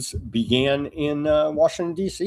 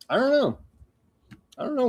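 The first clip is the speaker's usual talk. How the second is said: mock baffled squeak; 12 semitones higher, 3 dB quieter, much faster than usual. Mock shrug.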